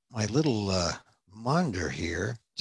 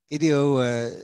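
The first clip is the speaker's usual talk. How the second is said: usual speaking voice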